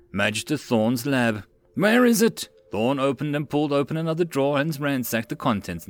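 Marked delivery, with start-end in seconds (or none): 1.8-2.5 higher, louder panicked search; frantic cry. A narrator's reading. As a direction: call out in a frenzy. Frantic search for missing vial.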